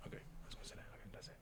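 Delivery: whispered aside. Real, not acted. Player whispering to herself after the slip, whisper. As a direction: breathe the words under your breath. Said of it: "whispered aside to herself".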